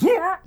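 loud, high mock retch; a sudden comic gagging noise; imitated gag. Co-host retelling a film's vomiting scene, mock gag. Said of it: "that person gags and goes".

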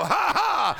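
high, loud forced "ha, ha"; shouted deliberate ha-ha; defiant laugh. Shown as a demonstration. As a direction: laugh in the devil's face. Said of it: "You go".

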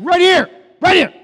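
high, booming shout as God; hammed-up exasperated holler; exasperated shouted reply. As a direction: yell it to get their attention. God shouts back to the doubter.

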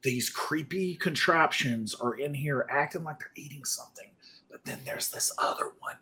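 soft, hushed whisper; barely voiced, confidential. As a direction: drop to a whisper, sharing a discovery. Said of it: "I don't know why I'm whispering".

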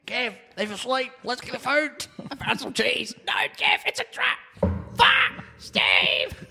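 high squeaky mouse voice, panicking by the end; tiny mice arguing in a high squeak. Pretend. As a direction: chatter like a frantic little mouse.

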